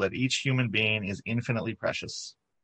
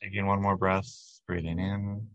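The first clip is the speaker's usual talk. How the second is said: guided meditation. Acted. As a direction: ease the congregation into calm, unhurried. Leads a guided body-light meditation.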